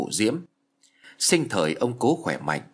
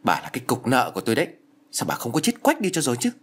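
fast, rising to a much higher spiteful cry; growling resentment; 4 semitones higher, faster than usual. Acted. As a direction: spit out the curse at the old burden. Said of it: "hầm hè nói".

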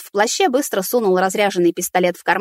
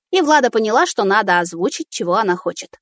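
usual speaking voice

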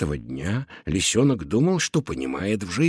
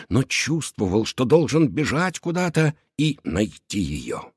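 usual speaking voice

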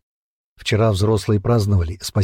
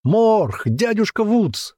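high, slow calling out; shouting; 11 semitones higher, much slower than usual. A narrator's reading. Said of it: "закричал он во всё горло".

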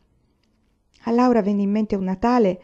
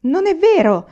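much higher, loud indignant cry; shrill protest; 10 semitones higher, 8 dB louder, slower than usual. Acted. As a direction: shriek it back in denial. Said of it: "strillò Grace". (strillo indignato, molto acuto e forte)

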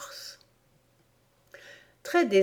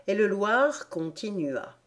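plain reading voice

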